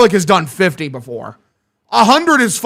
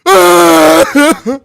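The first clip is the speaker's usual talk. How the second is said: Joe Swanson cry; 10 semitones higher, 9 dB louder than usual. Pretend. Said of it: "the Joe Swanson cry".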